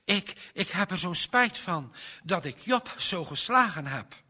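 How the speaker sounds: high, loud stammered start; urgent, high-pitched boy's confession; blurted apology. A narrator's reading. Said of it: "zei Johannes vlug".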